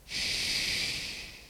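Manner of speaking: long soft shushing sound; hushing shh. Shown as a demonstration. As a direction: hush the whole sea. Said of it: "någon bara gjorde så här".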